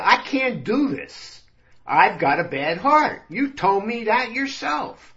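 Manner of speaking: rising whiny protest; high-pitched pleading. Acted. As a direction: plead like a scared patient. Vinny pleads with the doctor.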